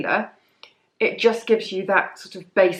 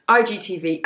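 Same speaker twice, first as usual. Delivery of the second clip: ordinary delivery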